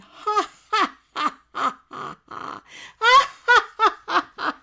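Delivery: loud, high "ha ha" laughs in bursts; laughing yoga demo. Shown as a demonstration. Showing laughing yoga over the dishes, loud exaggerated laughing. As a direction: force out exaggerated laughter, breaking into real giggles. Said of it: "and you're going".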